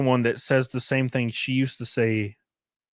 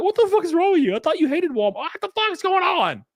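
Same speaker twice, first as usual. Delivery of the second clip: high-pitched incredulous outburst; imitating his own baffled self; exasperated disbelief; faster than usual. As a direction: pitch up in mock outrage. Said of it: "I'm like".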